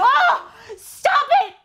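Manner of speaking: real fangirl squeal; delighted squeal. Real, not acted. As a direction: squeal like you can't take the cuteness. Fan gushing at the hug.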